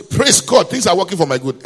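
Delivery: high, loud burst of praise; loud testimony. Acted. Passenger testifying in a silent bus, loud and high. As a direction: declare it out to the whole bus.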